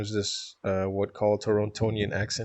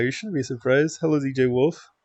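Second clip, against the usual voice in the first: ordinary talk, nothing marked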